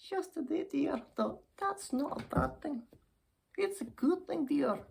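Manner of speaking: put-on high-pitched, agreeable old dear; cheery old lady. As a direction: play an old lady looking on the bright side.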